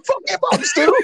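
high, laughing shout; hammed-up squeaky command; high mock yell. Retold Brody Lee yell.

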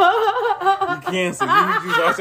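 very high, slow, laughing verdict; squealing mock pronouncement; laughing, drawn out. Real, not acted. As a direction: stretch it out through laughter.

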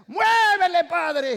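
high, loud, drawn-out cry to shake harder; mom's defiant shout. Retold or imitated. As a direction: shout at the sky, stretching the last muévele. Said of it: "decía".

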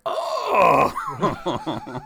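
loud, high, exaggerated gasp of realization; mock astonishment. Old men learn Americans qualify, loud hammed-up amazement. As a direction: imitate a big amazed "oh".

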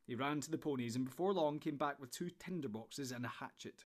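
ordinary delivery, nothing marked

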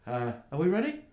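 gnome asking companion. Acted. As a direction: call the question over to a crewmate. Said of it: "her companion and goes".

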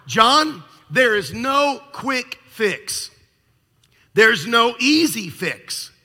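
doctor firmly telling John there's no shortcut; firm insistence. Retold or imitated. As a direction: lay down the law slowly.

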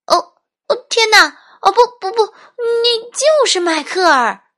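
very high, loud stammered exclamations; shocked recognition. A narrator's reading. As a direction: gasp out the discovery, then stretch the last line. Mother realises it is her son. (震惊地高声惊呼)

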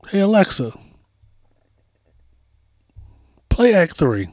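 mock yell to a smart speaker across the room. Star yelling out to Alexa mid card game, mock yell. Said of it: "Beyonce yells out".

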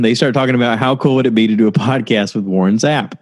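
ordinary talk, nothing marked

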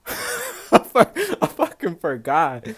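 laughing through the words. Real, not acted. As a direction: giggle out forgetting the word. Laughs at forgetting.

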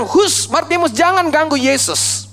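high-pitched mock scolding; acted-out telling-off; scolding disciples. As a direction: shush him like an annoyed disciple. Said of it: "Murid-murid memarahi Bartimeus".

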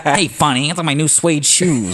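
high-pitched cartoon voice, drawn out; hammed-up high cartoon complaint; cartoon character impression. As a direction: whine it like a cartoon kid. Imitating cartoon character from Doug.

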